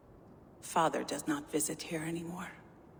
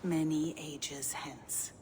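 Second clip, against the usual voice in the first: slow, drawn-out eerie whisper; slower than usual. Acted. Gunnlodr whispering of far-off ages, slow. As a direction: let the words trail off in a hush.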